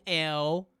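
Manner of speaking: usual speaking voice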